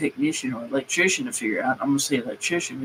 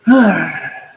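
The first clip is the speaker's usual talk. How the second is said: loud exasperated exhale; heavy sigh; 3 semitones higher, 10 dB louder than usual. Real, not acted. Mechanic worn out by engineers, heavy sigh. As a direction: let out a big fed-up sigh.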